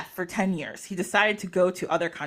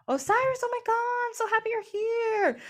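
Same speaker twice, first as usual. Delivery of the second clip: much higher, sugary fake delight; fake gushing welcome; 13 semitones higher than usual. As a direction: lay it on thick, pretending to be thrilled. Scheming Set greets Osiris fakely.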